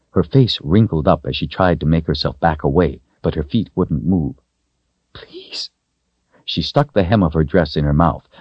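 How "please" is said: tiny unvoiced plea; frightened whimper. A narrator's reading. Girl cowering before the wizard, whispered whimper. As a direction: whisper it, terrified and small. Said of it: "biting it as she whimpered".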